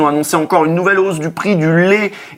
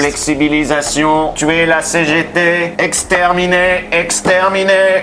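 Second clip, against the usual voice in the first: slow, flat robot voice; slower than usual. Retold or imitated. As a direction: intone it like a menacing machine. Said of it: "C'est le robot macroniste".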